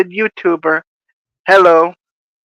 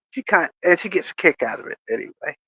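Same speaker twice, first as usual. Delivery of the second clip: ordinary talk, nothing marked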